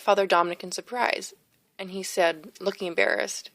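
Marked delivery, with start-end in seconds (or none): none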